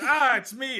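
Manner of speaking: high, loud teasing shout; playful dwarf jump-scare. Retold or imitated. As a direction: pop up and shout it gleefully. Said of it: "he jumped up and said".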